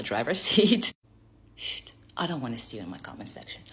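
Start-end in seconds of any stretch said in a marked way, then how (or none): 1.6-3.6 whisper. Acted. Hushed aside to viewers.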